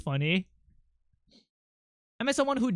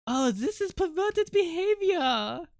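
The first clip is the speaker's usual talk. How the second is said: high-pitched mock French accent; French woman impression; 10 semitones higher than usual. Streamer reacting to a cartoon as the French woman, mock French accent. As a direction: play the scandalized Frenchwoman, badly accented. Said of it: "I'm gonna start talking like her".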